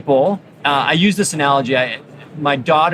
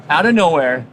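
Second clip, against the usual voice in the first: ordinary talk, nothing marked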